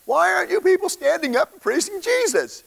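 hammed-up exasperated high voice; frustrated outburst. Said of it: "But he was like".

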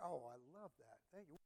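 ordinary delivery

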